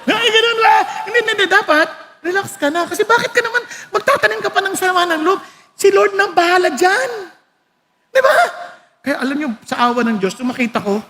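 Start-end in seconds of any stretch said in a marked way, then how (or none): none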